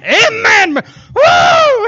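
high, loud amen into a whoop; explosive congregation shout. Retold or imitated. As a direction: burst out from the pew. Shouts amen, preacher jumps.

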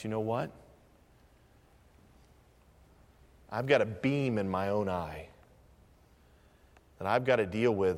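low, soft, confessional sermon voice; hushed, low-pitched pulpit reflection; humbled preaching. Real, not acted. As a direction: drop right down and admit it soberly.

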